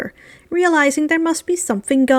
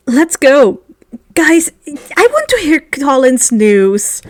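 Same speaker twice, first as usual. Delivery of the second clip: louder, eager call; urging; 5 dB louder than usual. Acted. Neville urges the group to go hear Colin's news, louder. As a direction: hurry the friends along.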